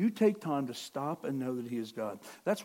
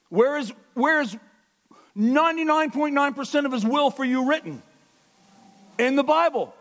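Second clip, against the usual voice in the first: loud, high, fervent preaching; preaching at full force; 9 semitones higher, 10 dB louder than usual. Acted. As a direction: drive it home to the congregation.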